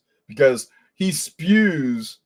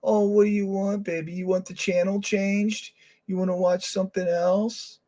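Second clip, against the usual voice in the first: imitating dad to baby; 6 semitones higher, 3 dB quieter than usual. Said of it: "and he goes".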